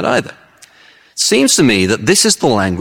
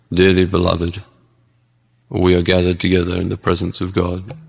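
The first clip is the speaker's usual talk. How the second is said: low monotone wedding address; depressed minister; 5 semitones lower than usual. Acted. Depressed minister opening a wedding, gloomy and droning. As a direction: drone it lifelessly, as if at a burial. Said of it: "he is so depressed".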